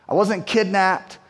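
usual speaking voice

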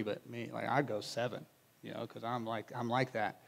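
mock-proud aside about his own count; smug Peter bragging. Pastor imitating Peter bragging to the other disciples, softly smug. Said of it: "he's probably saying it like".

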